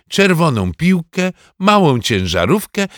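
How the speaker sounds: ordinary delivery, nothing marked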